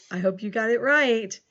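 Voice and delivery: usual speaking voice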